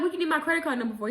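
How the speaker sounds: fast, high-pitched suspicious question; incredulous challenge. Retold or imitated. Acting out her challenge to the caller.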